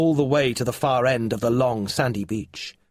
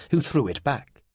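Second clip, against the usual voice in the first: ordinary delivery, nothing marked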